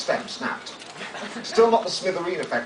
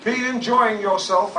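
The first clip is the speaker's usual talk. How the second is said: slow, drawn-out stress on enjoying, louder and higher; loaded emphasis; 6 semitones higher, 5 dB louder, slower than usual. Retold or imitated. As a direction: lean hard on enjoying as a double meaning. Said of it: "I said, with loads of emphasis".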